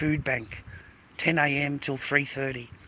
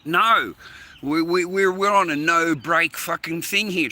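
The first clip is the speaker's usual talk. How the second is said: raised, louder complaint, slowing on the last words; loud outburst; 4 semitones higher, 7 dB louder, slower than usual. Real, not acted. Griping to the waitress about no breaks, loud and fed up. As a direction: blurt it out, fed up.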